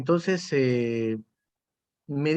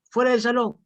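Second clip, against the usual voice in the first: louder, higher mock command to leave; stern order; 8 semitones higher, 4 dB louder than usual. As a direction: throw him out like a strict teacher. Teacher sending pupil out. (orden tajante del profesor, más alta y fuerte)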